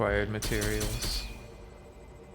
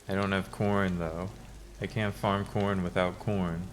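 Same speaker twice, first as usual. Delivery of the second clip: usual speaking voice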